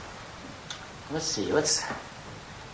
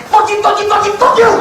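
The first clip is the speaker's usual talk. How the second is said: high, loud repeated outburst; venting curse; 9 dB louder, faster than usual. Acted. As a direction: let the bottled-up curse explode. What the thank-you really meant.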